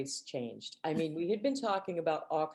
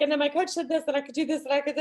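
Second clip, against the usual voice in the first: higher, louder, rushing gush about her coach; excited teen gushing; 8 semitones higher, 4 dB louder, faster than usual. Retold or imitated. Mimicking the tennis-mad girl to her listener, excitedly gushing. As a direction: imitate the girl bubbling over about tennis. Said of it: "she was so excited".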